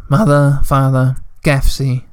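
plain reading voice

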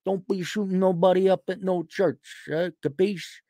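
put-on old-time Chicago mobster patter; hammed-up thirties gangster impression; 1930s Chicago gangster. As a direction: play a mock gangster from an old movie. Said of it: "some nineteen thirties Chicago gang".

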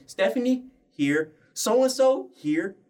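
high roll-call voice, calling out names; a hint of a teacher taking attendance; imitating gym teacher.

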